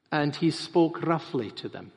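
ordinary talk

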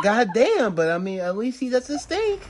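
normal talking voice

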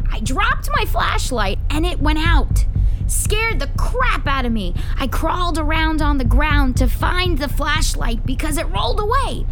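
high, loud, dramatic storytelling; excited, spooked account. Acted. Boy tells friends of dropping flashlight in darkness, excited. Boy recounts scary cave discovery.

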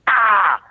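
high, loud "ah" jolt; mock scare yell. [name] explaining suspense, yelling the scare again. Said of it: "what sets the ah up".

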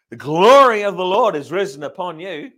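loud, ringing proclamation of scripture; proclaiming. Real, not acted. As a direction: declare it to the whole room. Preacher proclaims scripture verse.

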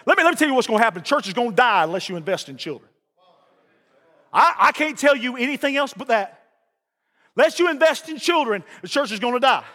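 usual speaking voice

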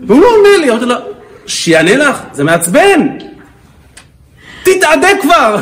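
hammed-up high, loud angry shrieking; scary wife's outburst. Wife yells that husband won't answer, mock fury. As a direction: imitate an exasperated wife exploding at her husband. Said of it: "אפילו אותי את מפחידה".

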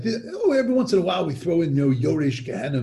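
usual speaking voice

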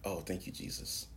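ordinary talk

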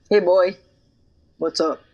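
low, tough bouncer voice; intimidating bouncer voice. Shown as a demonstration. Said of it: "Let me see".

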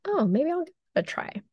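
high, quick, tentative curiosity; curious listener voice. Pretend. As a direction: play a newly curious listener. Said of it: "Maybe you'll be like".

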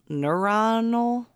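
"neuronal" said slowly as a question, rising at the end; questioning pronunciation. Shown as a demonstration.

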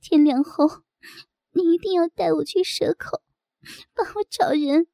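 tearful, high-pitched pleading; weepy entreaty; crying. A narrator's reading. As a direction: plead through sobs, voice lifted high. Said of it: "我哭着说".

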